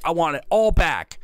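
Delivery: high, firm demand. Acted. Little Viking demanding his things back, high and firm. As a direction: say it once, firmly.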